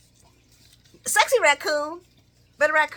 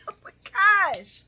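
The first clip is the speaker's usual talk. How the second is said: ordinary talk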